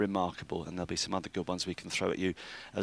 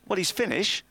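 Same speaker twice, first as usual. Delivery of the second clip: put-on higher, playful Finnish accent; 10 semitones higher, 3 dB louder than usual. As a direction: keep the Finnish voice going. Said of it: "Is that your Finnish impression".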